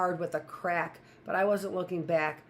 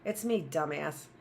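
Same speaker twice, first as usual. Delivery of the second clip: usual speaking voice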